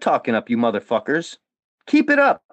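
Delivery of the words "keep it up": taunt. Real, not acted. Taunting his critics.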